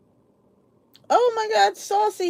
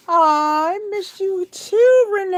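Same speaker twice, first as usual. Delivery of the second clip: cooing aw; 9 semitones higher, 5 dB louder, slower than usual. Real, not acted. Answering viewer's fond message.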